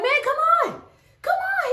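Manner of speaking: high, impatient call to come over; calling across the street. Retold or imitated. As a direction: wave your friend across, impatient. Calling friend across traffic.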